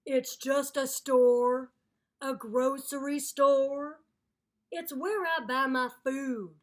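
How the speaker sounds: loud, slow, fed-up explaining; exasperated alligator. Acted. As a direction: spell it out to the narrator, annoyed. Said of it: "said Snapsy".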